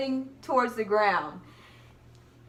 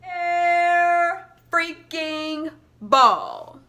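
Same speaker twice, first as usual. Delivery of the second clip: higher, louder, very slow disbelief; loud exasperated groan; 6 semitones higher, 7 dB louder, much slower than usual. Retold or imitated. Her reaction to her own airball, loud and dragged out. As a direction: drag every word out in frustration. The shot misses completely.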